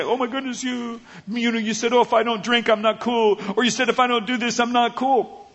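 high mock whine of peer pressure. Believer mocking peer-pressure excuses, high mock whine. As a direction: parody the whiny pressure with a sneer. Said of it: "I don't have to say".

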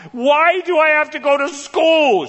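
put-on high, slow whining child; whiny kid. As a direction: whine like a kid refusing school. Son whining to mom.

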